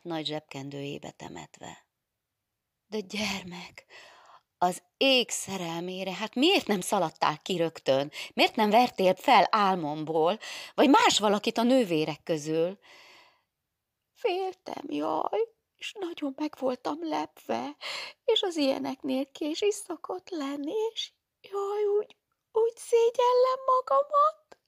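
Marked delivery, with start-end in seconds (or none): 2.9-12.8 put-on nun chiding the weeping girl; worried nun. Nun scolds gently, then louder. 14.1-24.6 high, whimpering, ashamed; weeping girl explaining to the nun; tearful girl. Acted. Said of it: "el is sírta magát".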